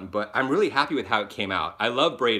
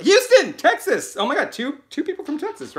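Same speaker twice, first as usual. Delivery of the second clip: thrilled shout-out; 14 semitones higher, 6 dB louder than usual. Real, not acted. Thrilled at Texas viewers.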